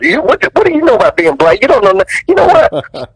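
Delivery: high, stumbling, offended protest; retold indignant outburst. Actor retells arguing back at the director, indignant. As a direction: act out being too affronted to finish.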